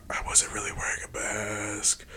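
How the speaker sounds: whispered, almost no voice; whispered confession. Real, not acted. Confessing to his podcast partner in a guilty whisper. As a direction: let it slip as a hushed secret.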